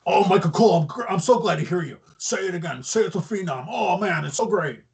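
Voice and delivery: The Undertaker gushing. Retold or imitated. Undertaker showing up to Raw, gushing at Michael Cole. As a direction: imitate someone overjoyed, tumbling along. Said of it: "and then he's just like".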